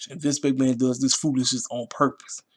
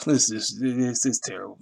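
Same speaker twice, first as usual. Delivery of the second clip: ordinary talk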